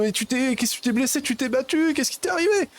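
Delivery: high panicked father; a father panicking over his son. Acted. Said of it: "il panique".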